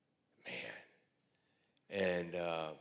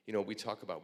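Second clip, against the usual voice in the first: normal talking voice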